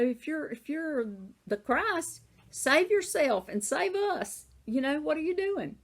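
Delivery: shrill mock challenge, quick on the end; mocking criminal. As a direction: sneer at him like the mocking criminal on the cross. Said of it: "was hurling abuse at him".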